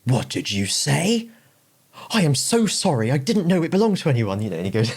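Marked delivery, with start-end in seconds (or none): 0.0-1.3 acted line. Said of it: "he's like". 2.1-4.4 high, apologetic mock grovel; scary pub guy turned meek. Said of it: "he's like".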